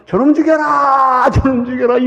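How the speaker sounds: high loud shout of a stone-throwing crowd; a furious high crowd cry; mob shouting. Retold or imitated. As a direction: roar for the pastor's death. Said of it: "돌을 들고".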